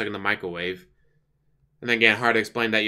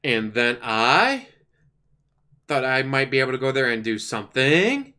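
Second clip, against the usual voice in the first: "I" and "something" drawn out, each sliding up like a question; mocking uptalk. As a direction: imitate uptalk, swooping up on "I" and "something". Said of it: "That up talk".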